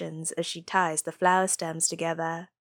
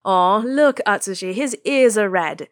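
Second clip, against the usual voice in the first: teasing delight; 3 semitones higher, 8 dB louder than usual. A narrator's reading. Friends teasing Natsume.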